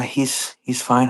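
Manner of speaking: ordinary delivery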